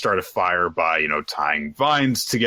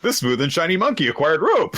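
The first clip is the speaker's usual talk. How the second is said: high mock item-pickup announcement; playfully pitched-up game prompt; video game announcement; 10 semitones higher than usual. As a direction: proclaim it like a game achievement. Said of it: "video game thing".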